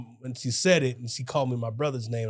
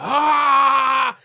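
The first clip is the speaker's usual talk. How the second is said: loud high group exclamation; hammed-up class reaction; class groan; 12 semitones higher, 9 dB louder than usual. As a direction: cry out like a whole classroom. Class reacts to stink joke.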